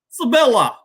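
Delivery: name said high in a mock Russian accent. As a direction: Sabella in a hammed-up Russian accent. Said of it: "Let me get my Russian accent ready".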